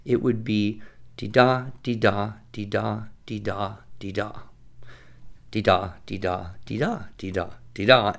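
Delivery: sing-song dee-DA, trailing off; iambic pentameter beat. Shown as a demonstration. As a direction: tap out five weak-strong feet. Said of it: "iambic pentameter".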